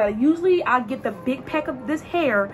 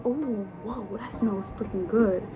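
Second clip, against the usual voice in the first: much softer, awed "whoa"; hushed delight; 3 semitones lower, 9 dB quieter, slower than usual. Real, not acted. Smelling the oil, soft and awed. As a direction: breathe it out softly, impressed.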